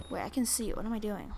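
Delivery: ordinary talk, nothing marked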